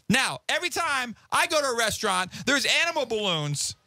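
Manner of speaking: normal talking voice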